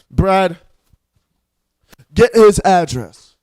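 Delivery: low, loud, slow villain boom; a loud, low, slow mob-boss command to his henchman Brad; menacing roar. Acted. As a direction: thunder the threat down at the enemy. Villain threatens, orders henchman Brad.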